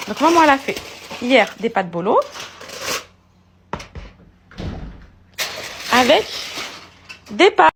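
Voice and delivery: high and loud teasing. Real, not acted. Loud playful scolding of baby. (mère taquine son bébé, voix forte et aiguë)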